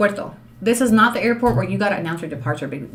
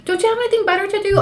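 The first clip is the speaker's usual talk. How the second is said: mock critic; 12 semitones higher, faster than usual. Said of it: "mocking critics' questions".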